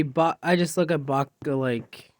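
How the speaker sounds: ordinary delivery, nothing marked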